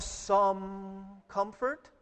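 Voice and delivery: "some" drawn out slowly, "comfort" rising as a question; questioning stress on "some". Shown as a demonstration. Preacher testing congregation on the verse, long questioning "some". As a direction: stretch "some" long, then lift "comfort" doubtfully.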